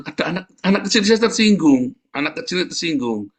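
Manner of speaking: excited. Real, not acted. Emphatic retelling.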